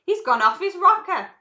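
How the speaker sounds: high, very loud outcry; aghast shout. A narrator's reading. An aghast father shouts about Wonka in the tunnel. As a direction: shout it in horrified disbelief. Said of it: "shouted one of the fathers aghast".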